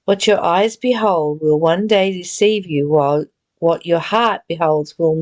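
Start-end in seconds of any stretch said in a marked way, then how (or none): none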